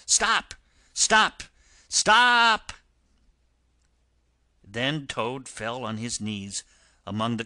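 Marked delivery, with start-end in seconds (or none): 0.0-2.7 high shouts building louder, last one drawn out; escalating high-pitched shouted command; shouting. A narrator's reading. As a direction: shout like a pursuing crowd, each call stronger. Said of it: "all shouting the same thing".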